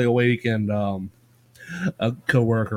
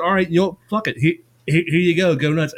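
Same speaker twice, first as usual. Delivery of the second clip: higher, louder retold outburst of giving up; exasperated giving in; 6 semitones higher, 5 dB louder than usual. Cat owner giving in to his fussy cat, exasperated. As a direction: throw up your hands, voice raised. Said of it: "And I said".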